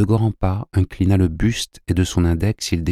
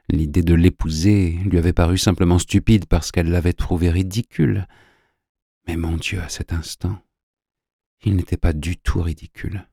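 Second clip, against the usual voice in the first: plain reading voice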